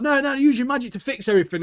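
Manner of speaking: high, quick insistent plea; mock insistent demand. Villager demanding magic fix everything, mock insistent. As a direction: imitate someone pushily demanding help.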